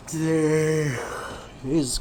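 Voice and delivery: dragged out through a yawn; slow yawning words. Real, not acted. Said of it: "I'm yawning a lot".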